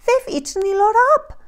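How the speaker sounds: put-on high, fast, upset baby bear squeak; tearful little bear. A narrator's reading. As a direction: squeal it like a bear cub about to cry. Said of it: "And he began to cry".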